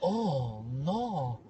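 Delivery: low, soft, drawn-out dismay; underplay it, barely concerned; deadpan dismay. Acted. Onlooker just watching the guy fall, deadpan dismay.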